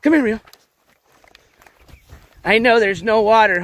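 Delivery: usual speaking voice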